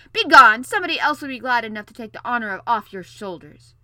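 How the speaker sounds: explosive shout, easing slightly after; furious, booming royal order; roaring dismissal. A narrator's reading. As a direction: banish them at the top of your voice. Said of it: "roared the king".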